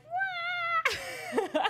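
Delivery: very high, long mock squeal; imitated crowd squeal. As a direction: imitate a whole audience freaking out. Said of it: "freaking out because it's".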